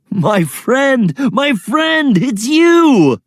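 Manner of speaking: high loud cry of recognition; joyful shout. Acted. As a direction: shout with joy at an old friend. Androcles recognizes the lion.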